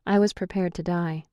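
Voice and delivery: ordinary delivery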